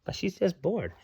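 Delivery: ordinary delivery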